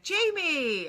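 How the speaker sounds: high, drawn-out mock cry of recognition; delighted greeting. Stu hears who is calling, imitated high excitement. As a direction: call the name out high, imitating his delight. Old friend greets her by name.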